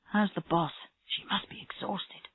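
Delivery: whispered, the last words almost breath only; hushed worry. A narrator's reading. Colleagues talk quietly while waiting.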